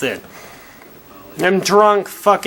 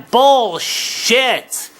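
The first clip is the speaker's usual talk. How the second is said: higher, emphatic scoff; angry outburst; 7 semitones higher than usual. Real, not acted. Drunk ranter calling out women's lies, angry scoff. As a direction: bark it out in contempt. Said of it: "I'm a little bit angry".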